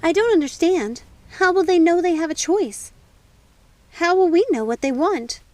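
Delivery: high, loud, pressing; anxious questions. Acted. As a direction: demand answers. Snow pressing the ancestors.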